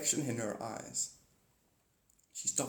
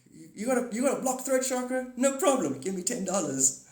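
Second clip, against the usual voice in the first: mock spiritual hustler; 9 semitones higher than usual. Healer selling a chakra fix, high slick pitch. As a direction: sell the cure like a chirpy hustler. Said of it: "It's like".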